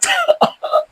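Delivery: very high giggling laugh; high mischievous laughter; teasing cackle. Acted. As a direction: cackle at the squirming patient. Teasing Pepe about his skin.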